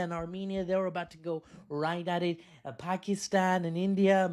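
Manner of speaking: a lightly voiced Donald Trump, whiny and boastful; Trump impression. Comedian mocks Trump missing the prize, mild Trump voice. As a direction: sulk like Trump robbed of a prize. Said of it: "Donald Trump".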